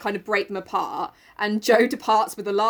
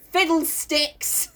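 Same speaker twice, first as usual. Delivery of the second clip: prim dismissive retort; 7 semitones higher, much slower than usual. Retold or imitated. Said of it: "Jo replies with this".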